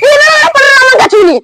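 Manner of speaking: panicked. Acted. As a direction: blurt it out in a panic. Panicked denial to the aunt.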